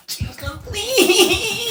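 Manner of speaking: high, laughing plea to the director. Pretend. Fans begging the director, laughing high plea. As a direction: squeal it out through laughter.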